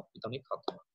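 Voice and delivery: quick whispered self-correction; whispered aside. Real, not acted. Whispering the correction to the audience. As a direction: breathe it as a private correction.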